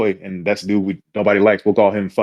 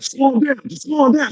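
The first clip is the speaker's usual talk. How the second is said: mock urgent pleading; 13 semitones higher, slower than usual. Jeff restraining a raging woman.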